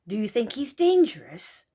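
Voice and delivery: plain reading voice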